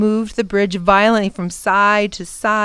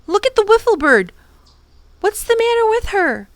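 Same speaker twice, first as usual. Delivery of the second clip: sharp alarm; 10 semitones higher than usual. A narrator's reading. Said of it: "Tom said sharply".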